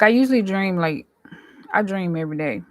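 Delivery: ordinary talk, nothing marked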